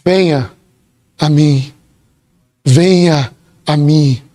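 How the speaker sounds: low, slow and gentle invitation; tender call. Retold or imitated. Jesus gently calling the weary to come to him, low and slow. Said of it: "aquele que diz".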